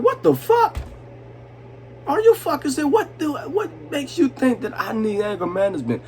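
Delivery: retelling the outrage at full pitch; outraged disbelief. Retold or imitated. Ranting at the psychiatrist, high indignant disbelief.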